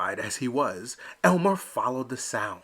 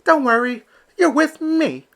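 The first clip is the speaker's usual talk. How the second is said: high, louder reassuring duckling; bright little son comforting his papa; Elmer answering; 12 semitones higher, 8 dB louder than usual. Acted. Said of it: "Elmer answered".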